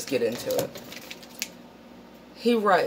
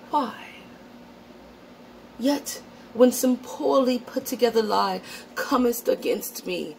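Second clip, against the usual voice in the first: mock-solemn Shakespearean reading, pitched higher; 7 semitones higher than usual. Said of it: "read it to you in a Shakespearean voice".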